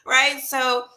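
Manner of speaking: ordinary talk, nothing marked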